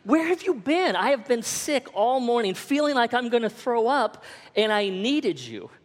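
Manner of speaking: high-pitched aggrieved rant, retold; angry added emphasis. Sick husband repeats himself to wife, angry emphasis. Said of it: "I repeated it for added emphasis".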